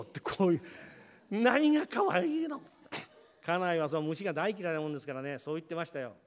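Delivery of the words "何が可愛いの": high, slow, disgusted wife voice; wife recoiling from a newt, high and slow; wife scoffing. Retold or imitated. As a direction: sneer at the child's pet, pitched way up.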